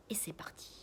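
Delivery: hushed unvoiced whisper of a spy setting off. A narrator's reading. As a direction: breathe it, barely a sound. Spy sneaking, about to start.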